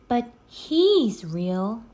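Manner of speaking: put-on a girl insisting, wide-eyed; Annie voice. A narrator's reading.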